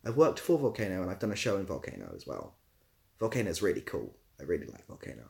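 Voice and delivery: ordinary delivery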